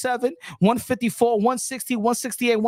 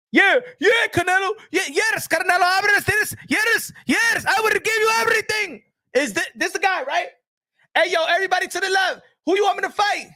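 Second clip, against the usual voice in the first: Canelo hams it up to the crowd, higher; impersonating Canelo; 9 semitones higher than usual. Retold or imitated.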